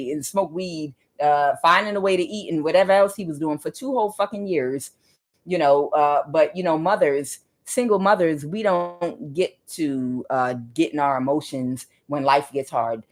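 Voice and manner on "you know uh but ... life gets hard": ordinary talk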